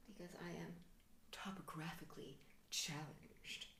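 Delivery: hushed confession; soft, low confiding whisper; conspiratorial whisper. Acted. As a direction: whisper it like a secret. Confessional aside.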